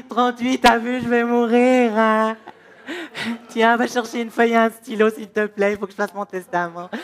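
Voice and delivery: loud, wailing man with a fever; hammed-up deathbed whining; man convinced he's dying. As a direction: moan as if these are your last words. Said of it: "on croit on va mourir".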